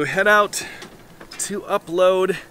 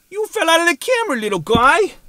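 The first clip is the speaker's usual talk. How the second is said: put-on high, quick baby-talk coo; 11 semitones higher than usual. Vlogger cooing at his fallen camera in baby talk. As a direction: coo at the camera like it's a toddler. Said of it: "I don't actually talk to my camera like a baby".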